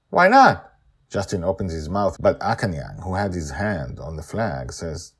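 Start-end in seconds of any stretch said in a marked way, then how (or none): 0.1-0.7 put-on high, loud child's protest; challenging child. A narrator's reading. Joy arguing with her dad, stubborn child voice. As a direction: toss back a stubborn why.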